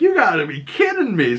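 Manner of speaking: high-pitched incredulous reaction; retold disbelief. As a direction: act out stunned disbelief. Acting own reaction to cliffhanger.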